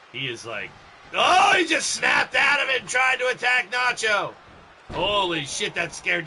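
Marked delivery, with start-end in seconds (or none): 1.1-4.3 loud, high startled outburst; startled shout. Real, not acted. Commentator startled by frozen wrestler attacking, startled shout. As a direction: cry out the sudden attack in shock.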